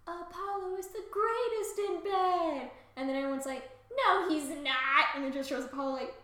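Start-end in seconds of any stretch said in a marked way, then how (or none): 0.1-2.7 high, drawn-out comic declaration; hammed-up proclamation. Comic Cassandra praising Apollo, exaggerated high proclamation. 4.0-5.1 slow, loud comic contradiction; mock loud denial. Everyone disbelieving Cassandra, loud drawn-out denial.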